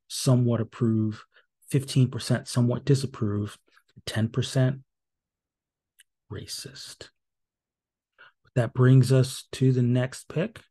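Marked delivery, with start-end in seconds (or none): 6.3-7.1 a dry, breathy whisper dropped at the end of the list; whispered aside. Acted. Reading the poll results to viewers, drops the last option as a sly whisper.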